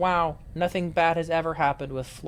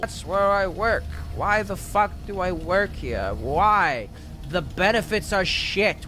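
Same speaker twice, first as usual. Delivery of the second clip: ordinary talk, nothing marked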